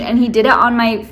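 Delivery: usual speaking voice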